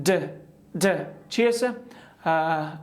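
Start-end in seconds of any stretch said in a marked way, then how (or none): 0.1-1.1 د repeated with the voice on; voiced d demo. Shown as a demonstration. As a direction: voice each د clearly. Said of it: "همین قسم". (د واکدار)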